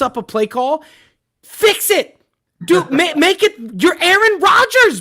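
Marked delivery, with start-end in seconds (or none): 1.4-3.5 ranting shout. Real, not acted. Ranting at Aaron Rodgers. 3.8-5.0 ranting shout. Real, not acted. Ranting at Aaron Rodgers.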